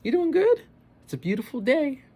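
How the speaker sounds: very high, sing-song friendly greeting; cheery store clerk. Retold or imitated. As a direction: chirp it like an overly cheerful clerk. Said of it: "he just be like".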